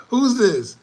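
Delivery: laughing. Real, not acted. Laughing at a startling photo.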